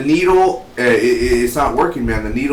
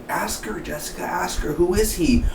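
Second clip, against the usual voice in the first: eager whispered urging; 7 semitones higher, 3 dB quieter than usual. Retold or imitated. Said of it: "I'm like".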